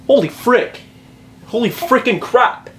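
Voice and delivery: a hint of a dad who never swears; prim dad. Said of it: "my dad doesn't swear".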